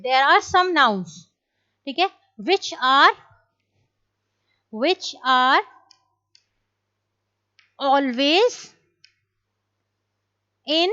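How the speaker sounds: slow, spaced-out dictation with long pauses; teacher dictating a rule; slow dictation pace. Shown as a demonstration. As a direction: dictate it word by word for students to copy. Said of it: "teacher dictates the rule slowly".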